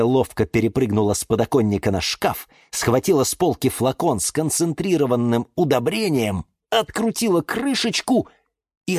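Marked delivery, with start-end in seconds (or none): none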